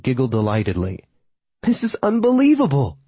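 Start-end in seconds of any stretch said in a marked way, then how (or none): 1.6-3.0 put-on high, delighted young woman's voice; crowing young woman. A narrator's reading. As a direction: crow it out with glee. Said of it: "she crowed".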